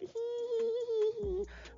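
long, high-pitched squeal; imitated swooning squeal; high giddy squeal. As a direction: draw out a thin, high whimper of delight. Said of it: "I'm like".

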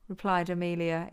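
ordinary delivery

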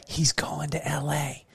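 soft whispered tip-off, slow; whispered secret. Retold or imitated. Host retelling how Nicole whispered a sports secret. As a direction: lean in and whisper the insider tip. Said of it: "pulled me aside and you said".

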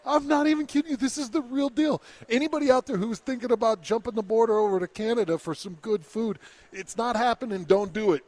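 high, insistent warning to the listeners; podcast host warning anyone heading for Canada; emphatic warning. Real, not acted. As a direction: urge everyone, earnestly, to stay home.

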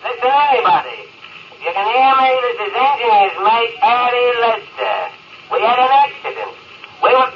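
hailing anyone who might hear, pitched high; desperate radio call, voice up high. Acted. Stranded man calling over radio.

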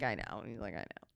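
very low, quiet confiding murmur; hushed agreement. Retold or imitated. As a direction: confide it under your breath. Imitating husband's conspiratorial quiet reply.

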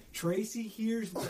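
high, drawn-out words through laughter; laughing through the story. Real, not acted. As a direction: crack up while telling it. Laughs while telling funny story.

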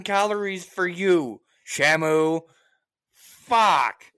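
high and loud, a worked-up tirade; heated rant. Real, not acted. Venting to a friend about rude shoppers, loud and worked up. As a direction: let the fury run away with you.

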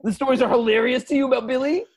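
high, fast indignant rant. Acted. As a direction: rant at them, outraged. Angry rant, high and loud.

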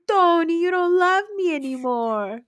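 high, loud whiny pleading to Tony; drunk girlfriend. Retold or imitated. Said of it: "She's like".

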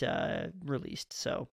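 ordinary talk, nothing marked

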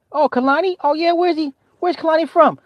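high, bright-pitched dad voice; dad asking his kid, pitched high and chipper. Retold or imitated. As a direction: play the eager, cheerful dad.

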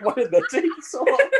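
high, laughing put-on voice; mock unimpressed woman.

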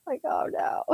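unvoiced, groaning little whisper; mock dread whisper. Therapist hearing kids approach, mock dread whisper. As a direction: whisper it like doom is coming up the stairs. Hearing the kids' steps coming.

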